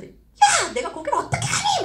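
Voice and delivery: high-pitched mock outrage; indignant refusal. Partner told to attend the training, high mock indignation. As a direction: squeal it like an exasperated partner.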